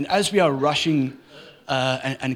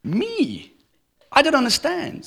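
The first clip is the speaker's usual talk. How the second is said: put-on much higher, childlike puzzled voice; daughter's surprised reply; 11 semitones higher, slower than usual. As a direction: squeak it like a confused little girl. To which she replied.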